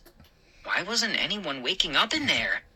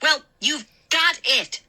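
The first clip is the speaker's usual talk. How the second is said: sarcastic exclaim; 10 semitones higher than usual. A narrator's reading. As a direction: fire it back sarcastically. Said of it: "Mindy exclaimed".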